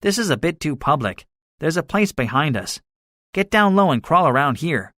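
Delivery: cautious gunner. A narrator's reading.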